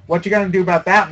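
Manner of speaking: usual speaking voice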